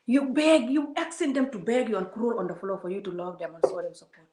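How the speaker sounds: ordinary talk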